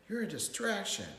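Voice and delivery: mildly exasperated parent; scolding. A narrator's reading. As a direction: tell him off without shouting. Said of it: "Daddy scolded".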